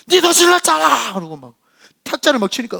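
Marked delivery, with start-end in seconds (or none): 0.1-1.1 high, loud defiant yell; young man shouting back. Retold or imitated. Big young man yelling back at the shaman. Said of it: "막 싸우고 막 그러더라고요".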